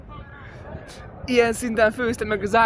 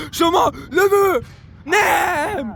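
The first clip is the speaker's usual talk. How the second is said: high excited yell; excited shout; 7 semitones higher, much slower than usual. Real, not acted. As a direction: shout the shot call. Shot at goal.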